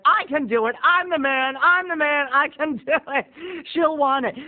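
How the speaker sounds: high-pitched acted sugar-rush psych-up; hyped self pep talk. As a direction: pump yourself up, high and eager. Pep-talking himself on sugar rush.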